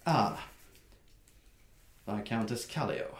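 quiet, icily polite greeting; cold greeting. Acted. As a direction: greet her with cold courtesy. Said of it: "looks at the human woman and goes".

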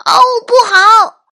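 put-on very high, loud child's cry; alarmed shout. A narrator's reading. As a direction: yell in alarm as a little boy. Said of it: "迈克尔叫道". (惊慌的高声喊叫)

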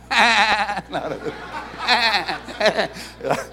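high, stuttering imitated goat bleats. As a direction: bleat in rapid bursts like a goat. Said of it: "¿Y las cabras?". (balido tembloroso de cabra)

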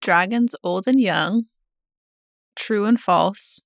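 slow, measured reading with a long pause between phrases. Shown as a demonstration. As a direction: recite it phrase by phrase, pausing between. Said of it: "but slower?".

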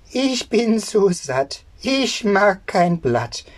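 high, slow sing-song goat; bleating goat. Acted. Goat telling the boy it is full, high and bleating. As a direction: draw it out high, then bleat. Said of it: "Und sie antwortete".

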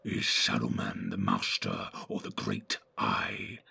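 very low, whispery orc; hushed, evil creature voice. A narrator's reading. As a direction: whisper it with sinister menace. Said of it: "said the evil voice".

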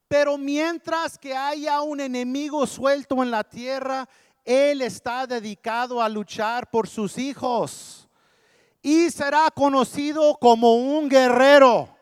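high, sustained preacher's cry; impassioned preaching. Acted. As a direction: build to a loud climax. Preaches at full force.

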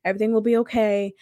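soft, quiet reassurance to oneself; soothing self-talk. Retold or imitated. Telling herself to breathe while crying, soft soothing. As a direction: gently talk yourself down, under your voice. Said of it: "I'm telling myself to breathe".

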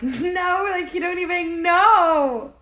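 high, loud, laughing "he don't even know"; amused high retort; laughing disbelief. Retold or imitated. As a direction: laugh it out, a little embarrassed. Laughing at baby father's absence.

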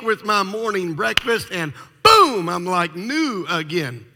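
patient begging the doctor for a magic pill; pleading whine. Retold or imitated. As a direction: beg for the easy way out.

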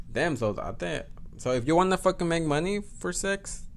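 ordinary talk

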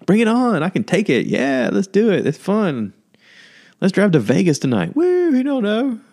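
high, loud hyped "bring it on"; mock party-kid enthusiasm; reckless youthful excitement. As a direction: whoop like a carefree twenty-something. Imitating carefree twenty-somethings.

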